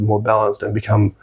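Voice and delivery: ordinary talk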